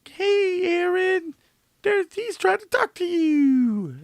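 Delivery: very high, drawn-out backseat passenger; Lisa impression. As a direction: squeal it slowly in a mock high voice. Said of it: "That's my impression of Lisa".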